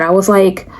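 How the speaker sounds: ordinary talk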